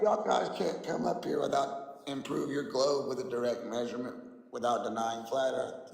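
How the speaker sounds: ordinary talk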